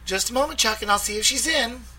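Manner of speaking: high, sweet receptionist voice; cute little voice. A narrator's reading. Receptionist putting the caller on hold, cute little voice. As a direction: keep it small and cute. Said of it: "the cute little voice said".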